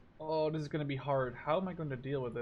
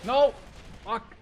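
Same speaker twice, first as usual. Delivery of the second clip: higher, louder cry of loss; dismayed cry; 9 semitones higher, 11 dB louder than usual. Real, not acted. Gamer crying out as his tank is lost, loud. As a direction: yelp as the tank dies.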